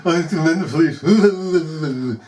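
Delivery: slow, laughing mimicry of him; mocking echo. Mimics S1's words, laughing.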